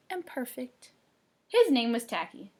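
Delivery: ordinary delivery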